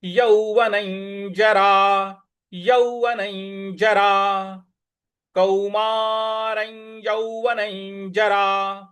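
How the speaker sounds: यौवनै जरा chanted slowly and deliberately; slow practice recitation. Shown as a demonstration. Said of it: "इया की तरह ले आना है".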